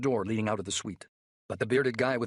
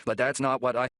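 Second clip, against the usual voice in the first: louder, cut-off protest with anger breaking through nerves; angry and nervous; 4 semitones higher, 7 dB louder than usual. Acted. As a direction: push back hard, then get interrupted. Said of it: "anger mixing now with his nerves".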